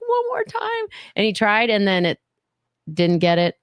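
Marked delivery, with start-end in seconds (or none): 0.0-0.9 very high pleading; desperate high plea. Retold or imitated. Fan begging the star for another photo, very high. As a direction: beg for one more shot, way up high. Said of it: "I'm like".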